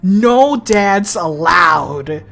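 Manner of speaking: slow, loud proclamation; drawn-out shouted rule; mock decree. Real, not acted. Player joking about anime dads, slow loud proclamation.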